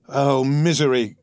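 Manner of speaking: drawn-out cry of misery; slow lament, not shouted; anguished cry. Acted. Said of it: "cried Franz".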